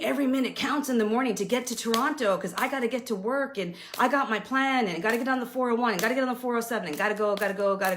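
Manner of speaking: fast, breathless rush of words. Real, not acted. Working mom describing the rush to Toronto, rapid rattle. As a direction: rattle it off like racing the clock. Rushing morning commute.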